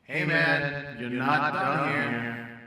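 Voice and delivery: loud, echoing voice from above; voice from the sky. Acted. Voice from the sky calls to the fallen man, booming and echoing. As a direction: boom it out slowly, drawing the words long. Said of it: "A voice from the sky said".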